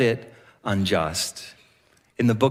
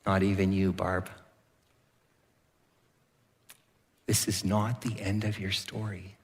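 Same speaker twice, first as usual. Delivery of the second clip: quiet, tender promise; hushed and earnest, leaning in close; soft sincere reassurance; 7 semitones lower, 7 dB quieter than usual. Real, not acted. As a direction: drop softer and speak gently to each listener. Quiet personal word to listeners.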